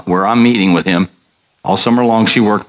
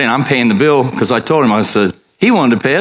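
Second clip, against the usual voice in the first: usual speaking voice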